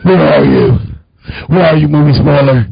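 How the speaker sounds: hammed-up caped crusader bellow; mock Batman voice. Mocking a friend, calling out the movie spoiler in a Batman voice. Deep gravelly Batman-style growl.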